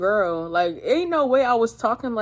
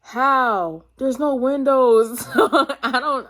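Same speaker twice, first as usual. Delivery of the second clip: louder, slow words breaking into a real laugh; laughing in disbelief; 5 semitones higher, 6 dB louder, much slower than usual. Real, not acted. Telling viewers about the sunlight with no windows, laughing in disbelief. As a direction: laugh it out, still baffled by it. Laughing at her own confusion.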